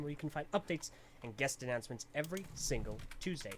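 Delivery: ordinary talk, nothing marked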